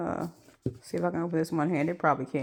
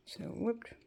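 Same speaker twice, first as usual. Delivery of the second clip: whispering; 4 semitones higher, 11 dB quieter than usual. Real, not acted. Reading product label.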